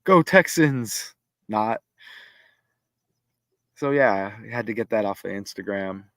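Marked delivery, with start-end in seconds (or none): 0.0-1.1 party cheering. Acted.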